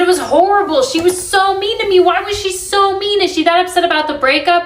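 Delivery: upset friend venting; upset complaint. Retold or imitated. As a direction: plead as if still hurt. Upset retelling to her friend.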